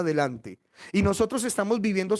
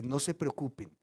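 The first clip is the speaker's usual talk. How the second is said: soft, lower-pitched comfort; calm frightened brothers quietly; hushed and soothing; gentle reassurance; 5 semitones lower, 10 dB quieter than usual. Acted. Joseph reassures his brothers.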